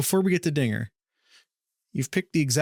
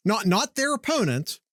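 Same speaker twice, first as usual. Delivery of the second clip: ordinary talk, nothing marked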